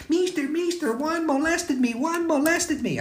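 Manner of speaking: put-on very high, urgent little girl voice; little girl complaining. Little girl runs up to tell the teacher, very high and urgent. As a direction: tattle to the teacher in a tiny high voice.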